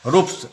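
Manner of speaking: रूप्स with a crisp unvoiced स at the end; dental s. Shown as a demonstration. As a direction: end रूप्स on a hissed, voiceless s. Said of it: "तो दंत स्व होगा".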